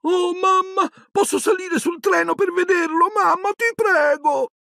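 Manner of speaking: put-on very high, pleading child voice; little girl's tiny voice. A narrator's reading. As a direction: beg mum like an excited small girl. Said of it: "udì la vocina della più piccola".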